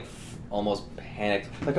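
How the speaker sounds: ordinary talk, nothing marked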